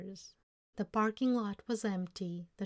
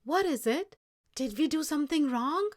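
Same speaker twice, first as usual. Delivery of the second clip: worried question; 8 semitones higher, 7 dB louder than usual. Acted. Grandfather worried, asks.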